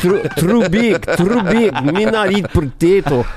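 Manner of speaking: slow, high mock Somali accent; broken English in a hammed-up Somali accent. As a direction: protest in a rough Somali voice. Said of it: "there's nobody from Somalia".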